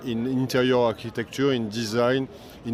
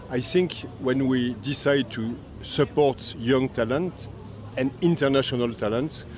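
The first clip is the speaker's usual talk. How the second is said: normal talking voice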